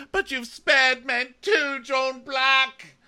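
high, loud, drawn-out mock plea; hammed-up indignant Sam; impassioned protest. Sam protesting to John about sparing men, impassioned.

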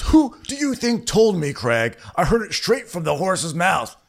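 high excited quote; quoted friend. Retold or imitated. Quoted Greg in argument.

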